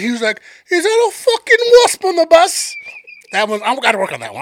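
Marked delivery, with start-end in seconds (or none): 0.7-2.8 put-on high, louder English accent; a rough English accent, much higher. English dude on bus spotting the wasp, English accent. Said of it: "I'll work on my accent".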